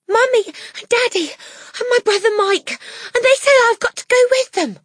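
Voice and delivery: put-on very high, loud child's outburst; frantic Matilda voice. A narrator's reading. Matilda tells teacher her family is fleeing, frantic and high. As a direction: blurt it out in panic at a high pitch.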